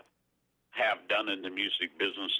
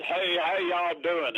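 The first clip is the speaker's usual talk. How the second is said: higher, drawn-out friendly hail; hearty greeting; 8 semitones higher, slower than usual. Retold or imitated. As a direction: call out a slow hello to visitors. Acted greeting to arriving visitors.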